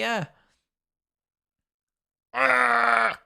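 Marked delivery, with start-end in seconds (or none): 2.3-3.2 frustrated groan. Real, not acted. Frustration at the delay.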